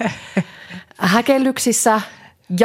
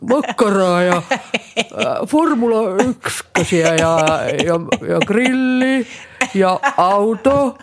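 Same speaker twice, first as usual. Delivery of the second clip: mock macho; much slower than usual. Mocking the men's dull topics.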